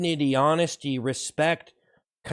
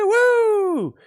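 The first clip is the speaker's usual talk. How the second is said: excited cheer; 4 dB louder than usual. Acted. Hype cheer.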